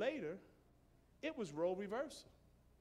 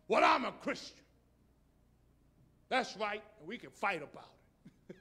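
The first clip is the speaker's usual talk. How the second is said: mock combative believer; 2 semitones higher, 8 dB louder than usual.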